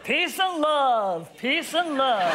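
slow, drawn-out imitation of [name]; a hint of [name] repeating himself; mock [name]. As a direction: lightly mimic a laid-back [name]. Mimicking [name]'s peace and love video.

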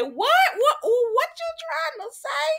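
high-pitched shocked what, drawn out; mock-scandalised falsetto; shocked squeal. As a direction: squeal it in disbelief. Shocked reaction to innuendo.

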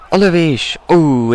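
high, loud shot call; excited commentary. Real, not acted. Shot just misses in game. (izgatott kommentálás)

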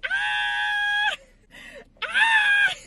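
high loud scream of joy; excited scream. Real, not acted. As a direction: shriek with delight. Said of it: "I'm screaming".

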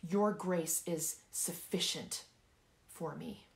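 slow right down onto "sufficient"; deliberate, emphatic "sufficient"; stress on SUFFICIENT. Shown as a demonstration. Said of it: "I want you to emphasize each word".

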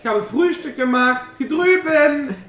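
bright, loud hostess calling; cheerful calling. Acted. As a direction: call everyone to breakfast, beaming. Innkeeper calls cheerfully to group.

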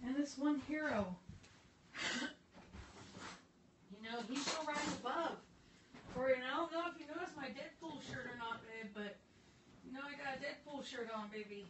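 soft hushed hero teaser; hushed dramatic. Pretend. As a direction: drop to a near hush.

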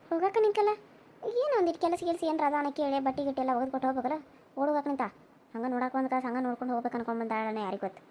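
a sour aside meant only for herself; muttered aside. Acted. Daughter-in-law grumbling aside about the guest, very softly. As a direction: grumble under the breath, very soft and low.

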